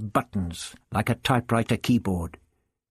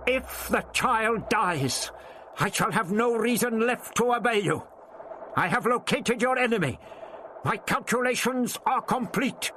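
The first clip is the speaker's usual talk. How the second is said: put-on high defiant old man calling up; shouting back; 11 semitones higher than usual. A narrator's reading. The Doctor shouts back to the dome to save Vicky. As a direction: bargain hard, calling upward. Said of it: "and shouted back".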